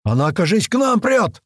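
high, urgent warning cry; alarmed shout. A narrator's reading. Gunner seeing the beast charge them, shouting. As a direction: shout a warning to your mates. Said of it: "закричал Няма".

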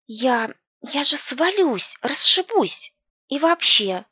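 high, loud panicked cry; scared of falling. A narrator's reading. Branch swaying, girl panics.

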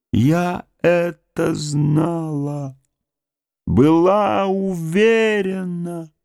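very high, very slow cry of triumph and pain; triumphant cry. A narrator's reading. Woman hears her name, very high drawn-out cry of triumph. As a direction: wail it in triumph and grief. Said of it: "ликующий и страшный крик".

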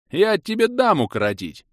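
возмущённая угроза на повышенном тоне; осади дочь, подняв голос; отцовский рык. A narrator's reading. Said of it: "рыкнул я". (высокое возмущённое рычание)

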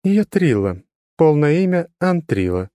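high, level, unchanging tone; monotone girl. A narrator's reading. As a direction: say it with no inflection. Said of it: "не меняя тона".

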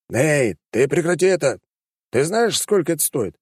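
sharp angry bark. A narrator's reading. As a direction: snap at him to stop. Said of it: "Пушкин рявкнул".

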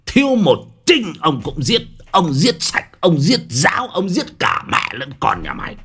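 high, strained threats, hammered one after another; furious yelling. A narrator's reading. Official threatening to kill the debtor's whole family, furious yell. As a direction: rage at them in rising bursts. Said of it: "mà gào lên".

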